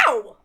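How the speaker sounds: one loud, sudden yelp; pained yelp. Acted. Akarsha kicked hard onto the bench, yelping. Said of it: "startle at Akarsha's yelp".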